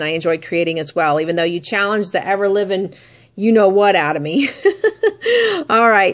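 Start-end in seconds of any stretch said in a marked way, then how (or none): 4.5-5.6 a high, bright burst of laughter; real laugh. Real, not acted. Vlogger joking to her swap friend about the challenge, real laugh. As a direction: laugh out loud at yourself.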